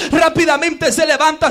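usual speaking voice

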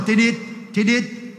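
steady beeping, tidit, tidit; monitor beep imitation. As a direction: mimic a heart monitor's even beep. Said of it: "monitor empezó".

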